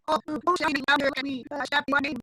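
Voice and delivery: high, quick little chirpy voice; high-pitched storybook chick; startled baby chick. Acted. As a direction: squeak it out like a tiny chick.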